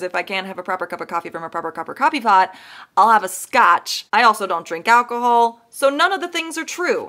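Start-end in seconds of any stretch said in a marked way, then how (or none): 0.0-4.1 fast tongue-twister delivery with a punchy scotch; quoting the singer's closing line at speed; rattled-off lyric. Retold or imitated. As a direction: race through it and land the punchline. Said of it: "she says".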